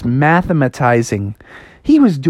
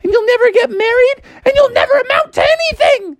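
high-pitched mom voice; high mock-motherly lecture; Froggy's nagging mom. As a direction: pile up dire warnings, pitch climbing higher and higher.

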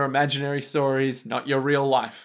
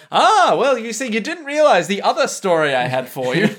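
raised, louder know-it-all reveal; grandly explaining a secret story; mock exposition voice; 6 semitones higher, 5 dB louder than usual. Co-host mimics returning character revealing backstory. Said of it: "just to be like".

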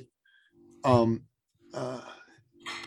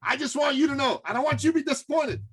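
skeptical client; 8 semitones higher than usual. Retold or imitated. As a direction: mimic a pushy skeptic who has heard it all. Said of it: "this one guy especially".

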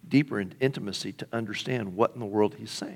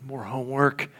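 mock complaint; 3 dB quieter, slower than usual. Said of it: "the Pharisees would go".

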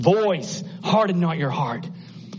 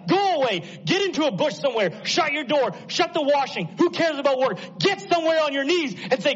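normal talking voice

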